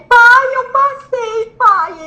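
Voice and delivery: high, stretched cry of joy; overjoyed phone call, acted out; re-enacted excited call. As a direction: call out the news, bursting. Retold excited call to father.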